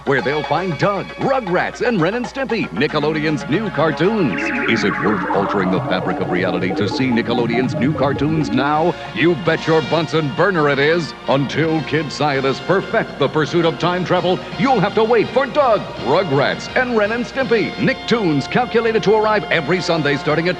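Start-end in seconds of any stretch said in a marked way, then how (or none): none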